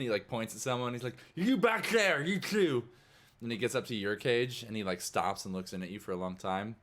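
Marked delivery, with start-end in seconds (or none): 1.3-2.8 high, loud shout across the room; guard calling out. Acted. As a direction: call a prisoner out from afar. Guard calling out prisoners in game.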